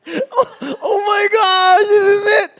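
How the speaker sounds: high, loud, slow burst of delight, laughing; thrilled outburst. Retold or imitated. Guy hearing she has news, bursting with excitement. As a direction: explode with giddy joy.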